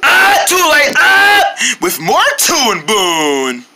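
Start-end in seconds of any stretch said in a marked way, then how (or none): 0.0-1.8 high, loud cry of defeat; frightened yelp. Acted. Caught by the logo, he yelps in loud fright. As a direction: yelp as it grabs you.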